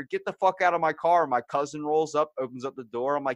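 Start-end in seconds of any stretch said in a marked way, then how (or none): 0.0-1.3 loud, higher-pitched order to get out; retold yelling. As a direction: re-enact yelling at an intruder. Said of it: "Started yelling at her".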